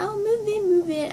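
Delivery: high-pitched fussy usher voice; imitated lady herding fans.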